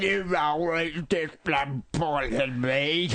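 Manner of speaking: slow, drawn-out gurgling mother; mock gurgle of a dying mother. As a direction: choke the words out like a dying old woman. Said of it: "That was pretty good, actually".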